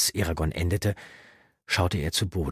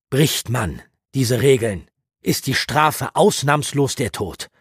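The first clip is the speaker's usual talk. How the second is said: put-on louder grave lecturing old mentor; belehrender Mentor; 5 semitones higher, 6 dB louder than usual. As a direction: explain the rules gravely. Brom erklärt Magie. (ernst belehrend, lauter)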